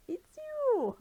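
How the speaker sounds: very high, tiny squeaky bird voice. Acted. Tulin greeting Link and Zelda, tiny squeaky voice. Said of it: "Tiny squeaky".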